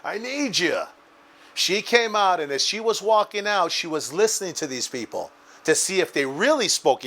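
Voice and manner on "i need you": high calling shout of Mama, fading on the last words; yelling for Mom. Retold or imitated. Son calling his mother from the back, high shout. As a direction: call out for help across the kitchen. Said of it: "I started yelling for Mom".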